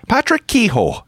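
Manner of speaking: Patrick Kehoe in a high lilting Irish accent. Shown as a demonstration. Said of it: "My Irish accent is the what the what".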